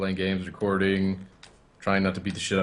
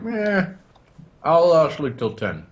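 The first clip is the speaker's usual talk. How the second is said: lazy mock drawl; 9 semitones higher, 5 dB louder, slower than usual. Quoting own lazy thought.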